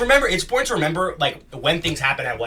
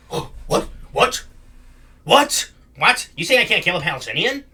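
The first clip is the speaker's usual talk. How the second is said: repeated startled disbelief, hammed-up; Ben Shapiro; 3 semitones higher than usual. Mimicking a commentator flipping out at an objection, mock incredulous.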